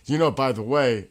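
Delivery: put-on tossing off a casual remark in a mild English accent; slightly put-on English accent, half-hearted. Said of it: "in his English accent".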